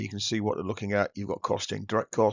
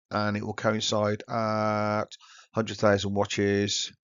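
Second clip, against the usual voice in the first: normal talking voice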